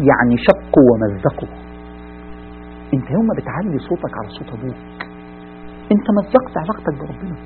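low, soft and slow, heavy with warning; hushed sermon. Acted. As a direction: drop the voice and let each word land like a grave warning. وعظ هادئ منخفض. (وعظ خافت بطيء بنبرة تحذير ثقيلة)

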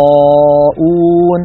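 يراءون with a dark alif after the ر; heavy alif. Shown as a demonstration. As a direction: draw out يراءون, alif full. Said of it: "الراء مفخمة فتبعتها الألف أيضًا في التفخيم". (تفخيم الألف بعد الراء)

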